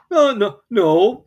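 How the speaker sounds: high, loud offhand denial; careless Pooh. A narrator's reading. Pooh tells Rabbit he isn't stuck, high careless voice. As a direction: brush it off as if nothing's wrong. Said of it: "said Pooh carelessly".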